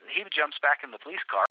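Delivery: ordinary talk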